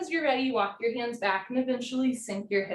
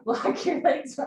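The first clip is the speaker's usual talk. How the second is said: laughing through breathless effort; a real laugh, a bit louder, while out of breath; 3 semitones higher, 4 dB louder, slower than usual. Real, not acted. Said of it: "I'm laughing 'cause I'm, like, breathing so hard".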